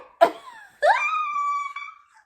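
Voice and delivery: high, loud burst of laughter. Real, not acted. Recalling mom's reaction, loud high laughter. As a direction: crack up at the memory. Laughing at her own story.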